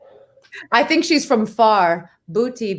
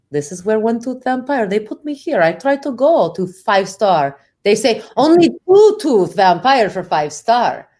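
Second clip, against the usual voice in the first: put-on aggrieved vampire with a thick Russian accent. One tooth vampire complains about the hotel, Russian accent. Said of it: "Your Russian accent".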